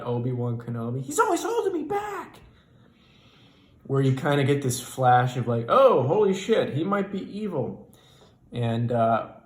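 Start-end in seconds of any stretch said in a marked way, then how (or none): 1.0-2.4 whiny rant. Retold or imitated. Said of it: "that Anakin Skywalker rant". 5.7-7.9 higher and louder, sudden shock; the moment it dawns on you he's evil; alarmed realization. Retold or imitated. Comedian's inner alarm.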